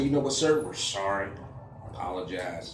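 soft, low, dropping to near whisper; calm apology. Retold or imitated. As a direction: apologize quietly and gently.

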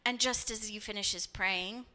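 ordinary talk, nothing marked

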